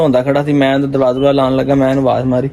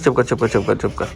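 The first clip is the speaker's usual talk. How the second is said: calm him down gently, almost under your breath; soft hushing; 6 semitones lower, 6 dB quieter than usual. Real, not acted. Soothing crying child.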